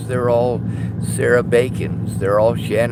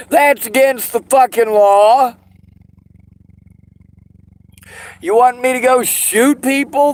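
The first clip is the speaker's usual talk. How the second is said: furious shout; 9 semitones higher, 6 dB louder than usual. Real, not acted. Furious rant about money and law.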